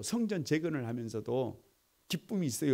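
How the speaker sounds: ordinary talk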